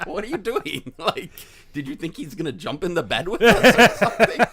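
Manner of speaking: high, loud retelling through laughter; laughing disbelief. Retold or imitated. Husband asking wife about her yelling, laughing. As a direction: ask it while cracking up.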